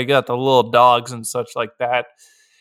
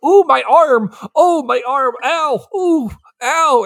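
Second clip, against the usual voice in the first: high, whiny ow-ow complaining; mock whining; 10 semitones higher, 3 dB louder than usual. Rambo whining about his arm after the fall, high whimper. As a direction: whimper over your arm like a wimp.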